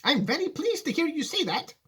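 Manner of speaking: high, earnest Wonka voice; serious Wonka. Acted. Wonka answering Charlie seriously, in a high voice.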